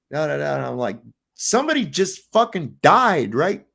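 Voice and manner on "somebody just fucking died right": high, louder exasperated protest; indignant outburst. Retold or imitated. Passenger scolding commuters annoyed about the delay, outraged. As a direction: scold them, outraged at their selfishness.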